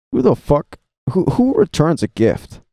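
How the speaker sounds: who would ever do that, sound it out; mock outrage at gift returners; incredulous outburst. Real, not acted. Mocking people who return gifts.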